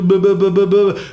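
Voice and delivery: loud, high-pitched wordless tirade; mock ranting. Boston stranger ranting at stranded driver, loud mock scolding. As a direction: rattle off blahs as a heated telling-off.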